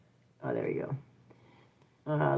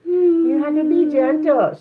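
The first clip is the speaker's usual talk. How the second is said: firm scolding; 10 semitones higher, 6 dB louder than usual. Real, not acted. Scolding rough toddler.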